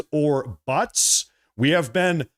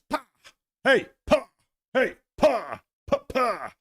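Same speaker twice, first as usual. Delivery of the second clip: sharp high mock slap sounds; backhand slap noises; 9 semitones higher than usual. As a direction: smack out each backhand like a slap. Imitating backhand slaps on world stage.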